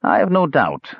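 a touch of sourness; dry, sour reply. A narrator's reading. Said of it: "said the mage sourly".

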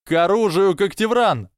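high rallying yell; shouted battle cry. A narrator's reading. Michael Corner joins the standoff, battle shout. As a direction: cry the house to arms, pitch leaping. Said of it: "выкрикнул Майкл Корнер".

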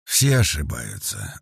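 thoughtful. A narrator's reading. Sergei answering praise of an expert, slow and thoughtful. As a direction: muse on it slowly and low. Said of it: "задумчиво произнёс Сергей".

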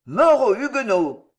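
loud high rallying cry, full force; crowd shouting. Acted. Mob pouring out of the church, shouting. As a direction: yell it like an angry mob. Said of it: "en criant".

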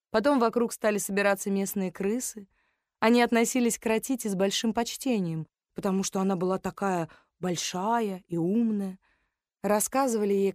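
usual speaking voice

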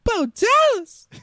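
very high, loud squeaky name call; slow freaky Mickey Mouse squeal; Bo Dallas. Retold or imitated. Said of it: "You sound like a freaky Mickey Mouse".

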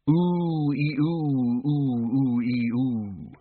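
drawn-out wavering vowels, ghost-like; long ghostly ooh-ee wail. Acted. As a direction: wail the written vowels slowly. Reading out the scrawled spooky note.

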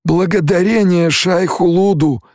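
very high-pitched exclamation; fervent follower. A narrator's reading. Stilgar running up to Paul, fervent high cry. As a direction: cry it out high in relief as you run up. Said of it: "отозвался Стилгар, подбегая к Полу".

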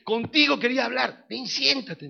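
loud, high, commanding father; padre severo. Retold or imitated. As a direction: confront your son and order him to sit. Padre regañón en el relato. (padre severo, voz alta y autoritaria)

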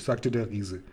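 usual speaking voice

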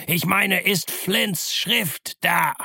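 high, impatient yelled question; slow, emphatic high bellow; cursing shout. A narrator's reading. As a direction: demand it word by word, exasperated. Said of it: "fluchte der Blinde".

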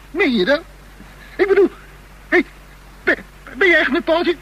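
high, loud, half-laughing disbelief; stunned disbelief. Acted. Bor reacting to Tera and Paul, high incredulous. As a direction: gasp it out, barely believing.

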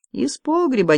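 a touch of sheepishness; reluctant admission. A narrator's reading. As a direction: admit it unwillingly. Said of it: "нехотя признался он".

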